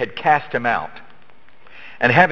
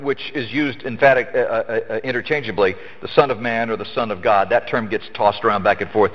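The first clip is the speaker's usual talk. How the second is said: ordinary delivery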